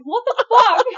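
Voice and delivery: loud, high-pitched mock yell; retold outraged yell. Sister hurt in a squabble, yelling in outrage. Retells reaction to hurt finger.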